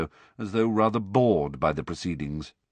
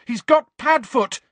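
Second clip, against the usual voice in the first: loud, higher desperate shout; 7 dB louder than usual. A narrator's reading. Harry shouting to Snape at the door, loud and desperate. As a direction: yell it before he leaves. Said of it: "he shouted".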